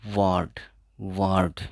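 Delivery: वर्ड said "waad", no r; वर्ड with the r silent, falling; silent r demo. Shown as a demonstration. Teacher showing students वर्ड with silent r. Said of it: "तो आर silent रहेगा".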